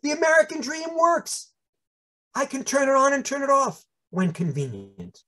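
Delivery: high-pitched mock-triumphant exclamations; exultant self-quote. Young academic thrilled to pass as a wasp, exultant. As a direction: celebrate each phrase like a dream come true. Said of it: "I experienced that as".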